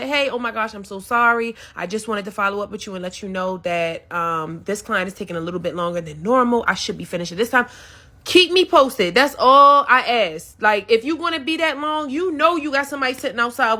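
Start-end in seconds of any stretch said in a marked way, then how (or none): 0.0-7.7 soft polite apology. Retold or imitated. Imagined stylist apologizing to the waiting client, soft and sweet. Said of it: "would have came out and said".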